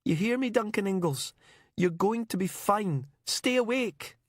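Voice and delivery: high, tearful and desperate; the words burning out of her; sobbing plea. Acted. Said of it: "She was sobbing now".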